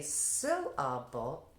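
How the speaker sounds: "syllable" slowly split into three clear beats. Shown as a demonstration. Teacher setting up a deletion drill, "syllable" slowly separated into its three parts. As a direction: say "syllable" part by part, stretched out. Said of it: "Now say".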